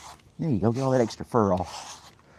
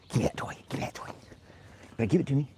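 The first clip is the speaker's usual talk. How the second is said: hushed teasing whisper; 4 semitones higher, 11 dB quieter, much faster than usual. Real, not acted. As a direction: tease the dog in a whisper.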